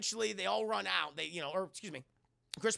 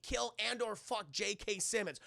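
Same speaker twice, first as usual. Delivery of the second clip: normal talking voice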